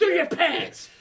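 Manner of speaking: high, loud accusing shout; scolding bus driver. Retold or imitated. Bus driver arguing with a student, shouted scolding. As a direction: lay into the kid, loud and high.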